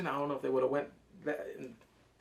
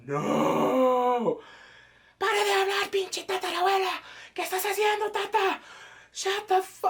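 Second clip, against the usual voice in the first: very high, loud scolding in Spanish; mock outraged yelling; 6 dB louder than usual. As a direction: shriek at her to shut up, half joking.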